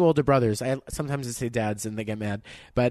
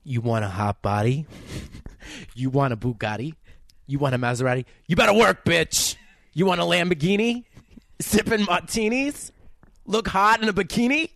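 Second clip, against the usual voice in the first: put-on Staten Island accent. Podcast host asks her to read [name] in a Staten Island accent. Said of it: "in a Staten Island accent".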